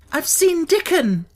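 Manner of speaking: insist, bursting with the news; excited. A narrator's reading. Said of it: "Mary repeats it louder".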